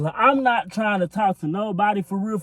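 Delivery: usual speaking voice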